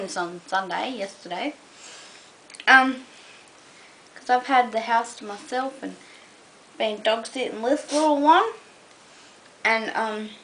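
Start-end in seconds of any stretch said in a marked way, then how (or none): none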